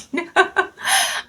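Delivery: bursts into a high, breathy laugh; real laugh. Real, not acted. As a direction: let a real laugh escape, airy and high. Recalls laughing after the call.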